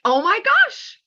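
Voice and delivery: high, wide-eyed gasp of discovery; amazed kids. Retold or imitated. Students realizing teachers talk, high and amazed. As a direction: gasp it like a kid who just figured it out. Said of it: "they're like".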